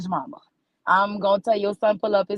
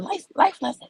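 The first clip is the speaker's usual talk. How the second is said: higher, hammed-up life lesson; mock grand announcement; 9 semitones higher than usual. Real, not acted. Comic giving the crowd life lessons, higher and hammed up. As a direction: squeak it out like a big decree.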